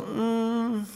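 a high, hesitant "Mm." held back, not ready to commit; a noncommittal little "Mm." with the pitch up; reluctant mm. Shown as a demonstration. Pastor shows the congregation how to politely decline, hesitant. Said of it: "you can just go".

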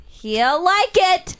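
high, slow, drawn-out teasing; slow sing-song tease. Shown as a demonstration. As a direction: stretch it out high and slow like a taunt. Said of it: "Coming up".

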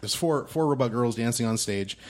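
ordinary talk, nothing marked

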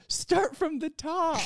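very high, drawn-out call from the back; imitated heckler call. Someone in the back calls to the performer, high mock heckle. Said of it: "someone in the back goes".

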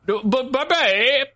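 lightly put-on British farewell, sing-song high; hint of a posh British goodbye, slowed "bye-bye"; British accent. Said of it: "in my British accent".

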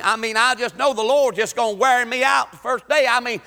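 ordinary talk, nothing marked

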